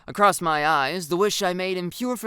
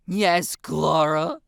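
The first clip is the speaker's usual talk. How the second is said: louder, exasperated groan; 5 dB louder, much slower than usual. A narrator's reading. Girl to giggling friend, groaning. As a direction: groan it out wearily. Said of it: "Jalen groans into the bed sheets".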